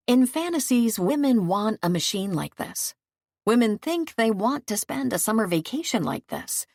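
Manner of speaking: ordinary delivery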